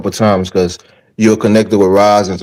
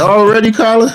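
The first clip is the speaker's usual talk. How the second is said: surprised; 11 semitones higher than usual. Real, not acted. Host surprised at guest's fatigue.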